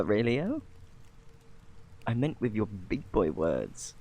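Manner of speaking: usual speaking voice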